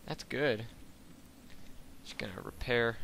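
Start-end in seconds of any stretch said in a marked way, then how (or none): none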